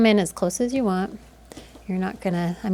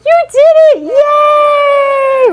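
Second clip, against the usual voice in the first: very high, loud cheer; 14 dB louder, much slower than usual. Real, not acted. Photographer cheering toddler, excited shout.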